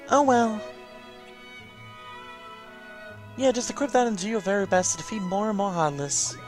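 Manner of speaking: encouraging familiar character. Acted. Familiar sending the player off to defeat Heartless, encouraging. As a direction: cheer them on as the game mascot.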